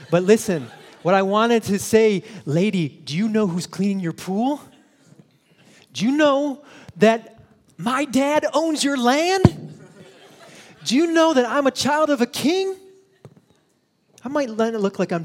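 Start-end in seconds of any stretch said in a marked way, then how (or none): 0.1-2.2 forceful call. Real, not acted. Preacher turns to challenge. 5.9-12.8 much higher, louder and slow, ringing; triumphant challenge. Real, not acted. Preacher's defiant challenge.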